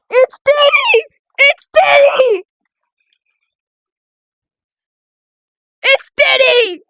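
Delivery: high, stretched-out panicked cry; mock alarm.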